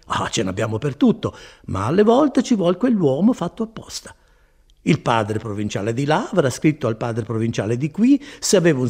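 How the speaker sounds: lightly acted friar, animated guesswork; lightly voiced chatty friar. A narrator's reading. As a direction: spin out a theory as the friar.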